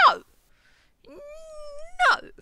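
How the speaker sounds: high, loud, drawn-out yell; shouted no. Retold or imitated. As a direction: yell a long no. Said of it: "because I'll say".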